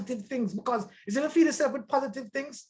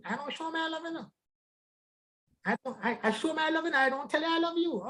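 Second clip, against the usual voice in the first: a hint of a woman, higher and softer; lightly voiced wife, softer; female voice; 7 semitones higher, 6 dB quieter than usual. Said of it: "a good rendition of the female".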